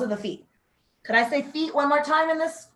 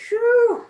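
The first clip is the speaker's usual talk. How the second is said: loud whew; 9 semitones higher, 8 dB louder than usual. Real, not acted. Exhale after forward fold.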